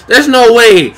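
real heated outburst; emphatic disbelief. Real, not acted. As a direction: call it out in protest. Reacting to the match.

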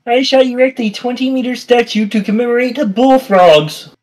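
high pompous tycoon declaration; grandiose voice. Pretend. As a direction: proclaim it grandly, like a vain billionaire. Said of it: "It's hard to talk in this voice".

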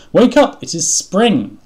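high, loud wake-up call; shouting. A narrator's reading. Frog rousing sleepy Toad, shouting. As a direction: shout the news to a sleeping friend. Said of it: "shouted Frog".

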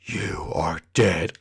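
low, very slow threat; slow, dark growing menace; menacing Shadow. Acted. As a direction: drag out a deep death threat.

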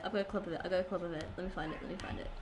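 quiet distracted mumble; muttering to self. Real, not acted. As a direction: mumble it while searching. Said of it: "muttering while searching".

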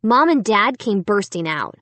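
ordinary delivery

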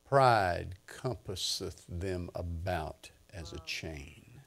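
slow, weighty reading with long pauses; scripture reading. Real, not acted. Preacher reading Psalm seventy-three to the congregation, solemn measured recitation. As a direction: read the psalm deliberately, phrase by phrase.

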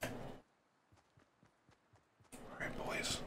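hushed unvoiced call to the squad; quiet stealth whisper. Real, not acted. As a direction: breathe it to the team. Sneaking, addressing teammates quietly.

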